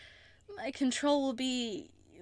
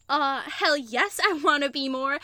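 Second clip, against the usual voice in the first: eager; 4 semitones higher, 9 dB louder than usual. Acted. Eager confession to partner.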